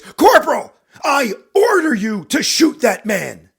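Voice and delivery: very loud, high, drawn-out roar; furious shout. Acted. Captain repeating his order to the corporal, enraged. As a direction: bellow the order at full fury. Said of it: "even more anger in his voice".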